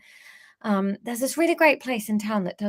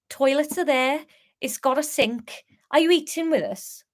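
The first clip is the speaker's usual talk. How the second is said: higher-pitched Scouse sliding into Welsh; attempted Scouse accent; 4 semitones higher than usual. Pub landlord asking guests about dinner, wobbly Scouse-Welsh accent. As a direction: try Scouse, drift Welsh at the end. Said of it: "that went Welsh on the last bit".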